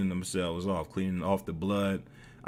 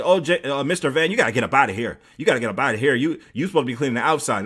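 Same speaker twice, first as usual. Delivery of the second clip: lightly voiced telling-off, a bit raised; advisor scolding; 4 semitones higher, 6 dB louder, faster than usual. Advisor ordering the helper out, mildly imitated scolding. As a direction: hurry him out with a mild mock scolding. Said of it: "She's like".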